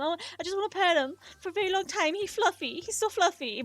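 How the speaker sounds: put-on high, gushing baby-talk over cute rabbits; gushing over bunnies. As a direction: coo like a kid melting over a fluffy pet. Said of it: "I'd be like".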